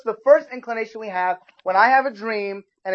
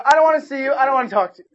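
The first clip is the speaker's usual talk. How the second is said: a louder, higher huffy parent's brush-off; mock upset parent; 5 semitones higher, 6 dB louder, faster than usual. An offended parent tells their child they won't talk to them, in a mock huffy voice. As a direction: play the sulking, offended parent. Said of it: "your parents get upset with you, they're like".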